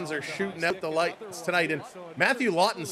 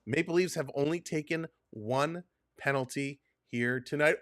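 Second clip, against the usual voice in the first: normal talking voice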